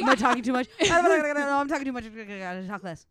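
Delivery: loud, laughing mock panic; second-guess yourself as a flustered suspect, loud then settling; panicky suspect. Imagined suspect monitoring himself under questioning, mock panicked. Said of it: "being a suspect with this knowledge?".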